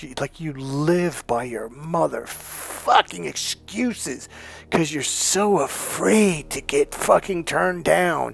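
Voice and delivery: slow, drawn-out emphatic scolding; scolding rant. Real, not acted. Coach calling out listeners who live by excuses, scolding. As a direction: stretch the key words to drive it home.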